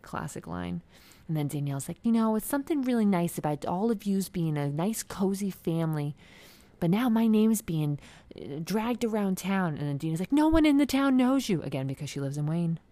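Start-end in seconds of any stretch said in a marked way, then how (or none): 2.0-9.8 loud, high-pitched mock complaint; Danielle. 10.3-11.6 loud, high mock retort; Dina. Acts out Dina's cutting retort.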